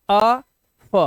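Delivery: अ and फ said as two separate short sounds. Shown as a demonstration. Teacher sounding out letters for learners, separate sounds. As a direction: sound out अ, then फ, with a gap. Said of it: "एक आवाज है अ की".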